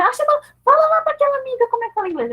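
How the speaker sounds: very high, quick coaxing voice; imitated pushy parent. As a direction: show off your child, high and hurried. Parent showing off child, acted. (mãe insistente, aguda e apressada)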